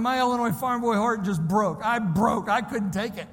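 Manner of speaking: ordinary delivery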